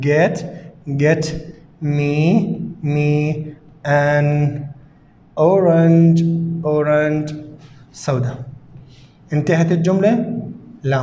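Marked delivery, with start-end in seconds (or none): 0.1-8.3 each word said slowly and repeated; slow dictation. Shown as a demonstration. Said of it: "teacher dictates word by word". (إملاء بطيء كلمة كلمة)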